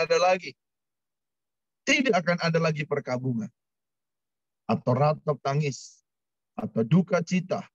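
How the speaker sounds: measured, solemn Bible reading; scripture reading. Acted. Pastor reads Revelation aloud to the church, solemn reading.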